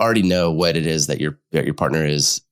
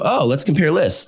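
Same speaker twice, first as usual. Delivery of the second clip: bright surprised; 9 semitones higher than usual. Retold or imitated. Therapist to the couple in session, mock surprised and bright. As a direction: pitch jumps way up on oh, playful discovery.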